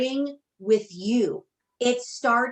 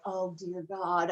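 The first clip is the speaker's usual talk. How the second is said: slow, softer, exasperated groan; eye-roll groan; 3 semitones lower, 6 dB quieter, slower than usual. Retold or imitated. Retelling her reaction to six thirty starts, a groaning eye roll. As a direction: drag it out like you're dreading it. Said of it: "I literally rolled my eyes".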